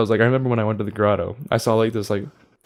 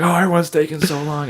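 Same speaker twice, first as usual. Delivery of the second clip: high-pitched mock complainer; whiny complaining; 5 semitones higher than usual. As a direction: imitate an impatient woman griping, pitched up. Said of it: "she was just bitching and complaining".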